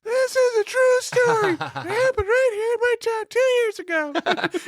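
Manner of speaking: put-on high, tiny little girl voice; little kid voice. Shown as a demonstration. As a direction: go all out as a small girl. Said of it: "I can go all out if you want me to".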